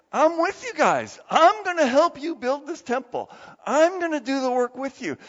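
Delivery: high, loud, rallying promise; rousing encouragement. Acted. God promising to help build the temple, rousing encouragement.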